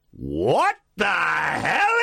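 drawn-out incredulous shout; loud disbelieving outburst; exasperated what the hell. Real, not acted. Host reacting to absurd question.

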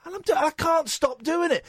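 high-pitched exasperated protest; annoyed put-on voice. Shown as a demonstration. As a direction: climb high in helpless irritation. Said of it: "I'm doing that voice again".

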